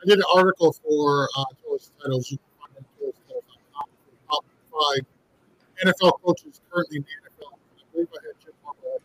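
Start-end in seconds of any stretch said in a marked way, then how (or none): 1.4-9.0 garbled, warbling bad connection; underwater. Real, not acted. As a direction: sound like you're underwater. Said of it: "it sounds like you're swimming underwater".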